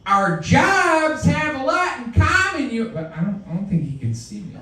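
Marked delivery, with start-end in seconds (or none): 0.1-2.9 high, slow, drawn-out call to a driver; slow shouted call. Pretend. Yelling out the window at the tanker driver, slow and high. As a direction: stretch it out across the lanes.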